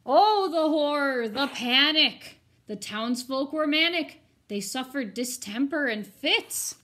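loud, slow, mock-dramatic storytelling; dramatic horror. A narrator's reading. Teacher dramatizing the town's panic for her students, loud and slow.

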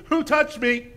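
loud, high demand over the crowd; sharp searching question; demanding call. Retold or imitated. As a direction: call out to find who touched him. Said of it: "turns around and says".

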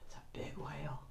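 unvoiced whispered aside. Real, not acted. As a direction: hush and whisper it. Aside to children.

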